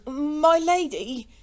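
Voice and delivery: high, drawn-out, helpless appeal; feeble protest. A narrator's reading. Innkeeper clumsily bars the lady's way, helpless. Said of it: "came in feeble accents from Jellyband".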